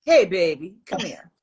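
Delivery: low, loud, seductive murmur; sultry voice. Shown as a demonstration. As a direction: purr it low and inviting. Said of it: "say in a very sultry voice".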